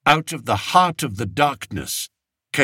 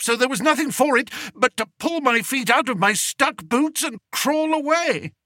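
agitated telling; 11 semitones higher than usual. Acted. King recounting his terror.

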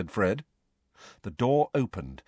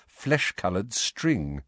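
usual speaking voice

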